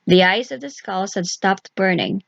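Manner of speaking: ordinary delivery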